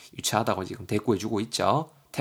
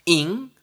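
nasal "ing" sound; 6 semitones higher, 5 dB louder, much slower than usual. Shown as a demonstration. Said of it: "이렇게 외웠었어요".

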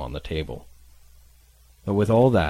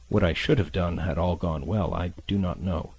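plain reading voice

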